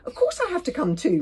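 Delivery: high, loud put-upon moan. A narrator's reading. Fishlegs insisting on coming to Hiccup's aid, moaning. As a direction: whine it in a high voice. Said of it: "moaned Fishlegs".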